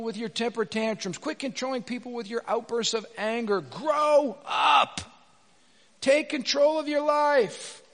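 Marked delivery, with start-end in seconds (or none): 3.6-7.8 high, loud, sharp command; shouted rebuke. Real, not acted. Preacher rebuking the congregation's tantrums, shouted. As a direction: yell it like a fed-up parent.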